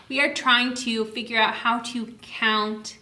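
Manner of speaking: ordinary talk, nothing marked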